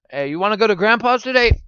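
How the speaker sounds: a light mock parent; parent asking. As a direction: ask the kids brightly. Acted family scene about visiting.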